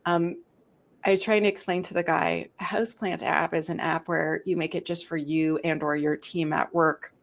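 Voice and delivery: normal talking voice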